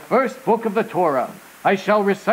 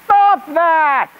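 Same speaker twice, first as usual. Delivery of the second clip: high, fed-up puppet outburst; high-pitched comic protest; exasperated yell; 8 semitones higher, 4 dB louder than usual. Acted. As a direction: cut off the singing with an exasperated cry. Rabbit fed up with repeated singing.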